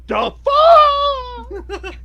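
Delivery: high, loud startled shout; shocked shout. Acted. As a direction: screech it in disbelief. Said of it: "and went".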